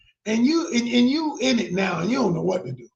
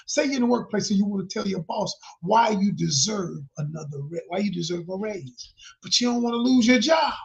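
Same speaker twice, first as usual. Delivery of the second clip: ordinary delivery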